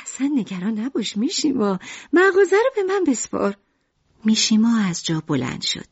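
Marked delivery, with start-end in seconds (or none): 0.0-3.6 high, bright-hearted mother's voice; Lucrèce playing the chipper wife; cheerful mother. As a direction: reassure him, pitched high.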